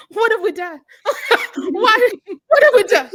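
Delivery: high, loud laughing wail; mock horror, laughing. Two hosts regretting their movie pick, laughing wail.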